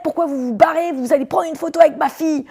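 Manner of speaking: high, loud furious father yelling at her; angry dad. Retold or imitated. Angry father yelling at the girl blocking the toilets. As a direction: bark it like an enraged dad who waited two hours.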